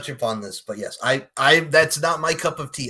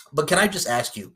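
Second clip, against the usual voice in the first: ordinary talk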